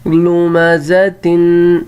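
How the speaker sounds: لمزتن drawn out, lu-ma-za-tin, ending on tin; slow model reading. Shown as a demonstration. Teacher to pupils, slow word with tanween. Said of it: "تو تن کی آواز آئے گی".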